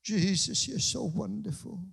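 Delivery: tender worship. Pretend. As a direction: linger over each word. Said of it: "sit down in His presence and say".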